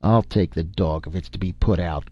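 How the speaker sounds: ordinary delivery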